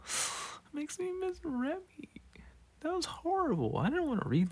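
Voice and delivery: high, breathy whisper near tears; tearful whisper. Real, not acted. Missing Remy after the bulldog story, whispering tearfully. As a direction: whisper it, choked up.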